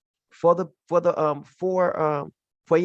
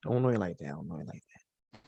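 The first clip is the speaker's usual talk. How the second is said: each repeat softer until almost breathless; fading to a murmur; 10 semitones lower, 9 dB quieter, faster than usual. Retold or imitated. Preacher echoing the rejection, fading away. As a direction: let it die away under the breath. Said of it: "That's what he said".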